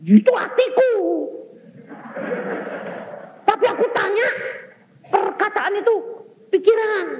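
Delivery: high, loud exasperated father yelling; shouting father. Retold or imitated. Preacher's father shouts back in exasperation, high and loud. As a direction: yell it at your son in frustration.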